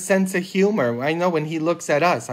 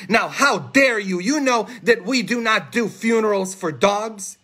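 higher, hammed-up rebuke; mock indignant priest; 5 semitones higher than usual. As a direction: act offended by an outrageous request. Priest rebukes woman in joke.

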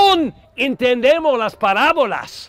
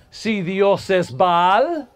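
usual speaking voice

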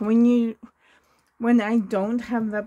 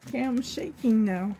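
ordinary delivery

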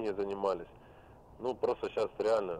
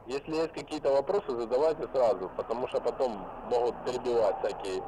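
ordinary talk, nothing marked

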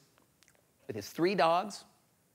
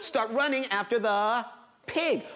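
ordinary delivery

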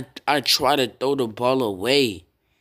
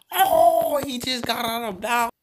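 excited yell; 12 semitones higher than usual. Real, not acted. Gamer watching his receiver run, excited high yell. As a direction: cry out as the runner breaks free. Gamer reacting to a big play.

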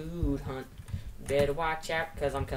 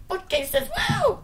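much higher, louder squeal rising to a whoop; excited squeal; 8 dB louder, much slower than usual. Retold or imitated. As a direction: squeal it out again like you just found treasure. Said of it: "That's why I said".

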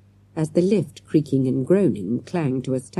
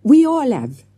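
put-on higher, louder French-accented sigh; 12 semitones higher, 8 dB louder than usual. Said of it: "sighing deeply".

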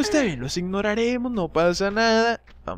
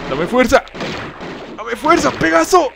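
high, loud cry building higher; battle plea; 8 semitones higher, 6 dB louder than usual. Real, not acted. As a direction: call on your champion for strength mid-fight. Pleading for strength in fight. (súplica a gritos en combate)

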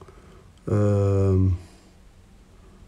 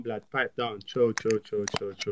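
hushed calming; 4 semitones lower, 9 dB quieter than usual. Real, not acted. Hushing a rude stranger down.

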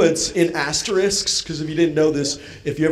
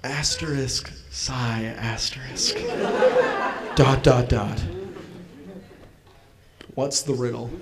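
low, subdued, retold resignation; imitating a deflated, annoyed dad; weary annoyance; 5 semitones lower, 7 dB quieter than usual. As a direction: give in quietly, fed up. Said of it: "just annoyed with me".

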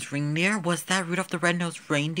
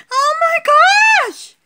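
much higher, louder screaming squeals; high doll voice shrieking with laughter; too excited; 10 dB louder than usual. Acted. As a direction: shriek with over-the-top joy. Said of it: "he was just too excited".

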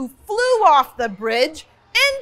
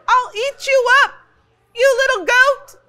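high, small troll voice; 9 semitones higher, 4 dB louder than usual. A narrator's reading. Scared troll threatens the big goat in a small high voice. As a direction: shrink the threat into a tiny squeak. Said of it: "in a voice that sounded quite small".

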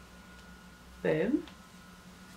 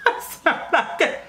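laughing; 11 semitones higher, 3 dB louder than usual. Real, not acted. Laughing at own words.

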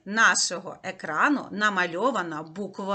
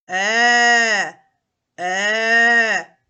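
"е" sung low and louder like a bear; low bear roar; 9 dB louder than usual. Shown as a demonstration. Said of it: "А тепер низьким, як реве ведмідь". (низьке гучне «е», як реве ведмідь)

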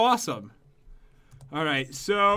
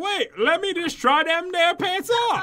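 mock hillbilly voice; 9 semitones higher, slower than usual. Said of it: "I was like".

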